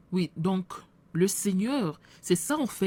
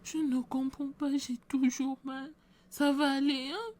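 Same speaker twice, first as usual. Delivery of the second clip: much higher, worried pleading voice; anxious sick woman; 7 semitones higher than usual. Acted. Sick woman asks the pastor if she'll heal, high and anxious. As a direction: beg for reassurance, pitched up and pained.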